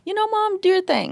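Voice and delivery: high sing-song encouragement; playful high-pitched nudge. Retold or imitated. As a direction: cheer mom on in a high light voice. Quoting self to mom, high.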